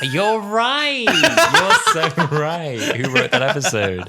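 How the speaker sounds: high, loud, laughing agreement; laughing realisation. Real, not acted. Agreeing with co-host about the episode, laughing loudly. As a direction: concede it through helpless laughter, drawn out.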